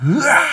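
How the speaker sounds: high battle cry; acted yell while running forward; short rallying roar; charging yell. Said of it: "하면서 달려가는 거예요".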